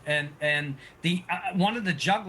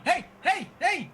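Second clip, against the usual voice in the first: high call for attention; juggler calling out; 12 semitones higher than usual. Acted. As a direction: call out to get him to throw it back. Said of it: "the juggler's going".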